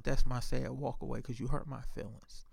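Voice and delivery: soft, understated imitation of hurt feelings; lightly acted sulk; mock sad. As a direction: say it small and quiet, playing wounded. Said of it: "like she was sad".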